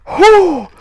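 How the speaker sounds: loud high "oh" of exertion; high cry on a hard rep; sudden loud whoop from strain; effortful whoop. Real, not acted. Exertion during last exercise reps.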